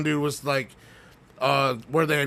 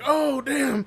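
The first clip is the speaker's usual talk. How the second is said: much higher startled gasp; mock startled; 12 semitones higher than usual. Retelling ghost hunters startled by a voice, high gasp. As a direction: caught off guard by a ghost. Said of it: "They're like".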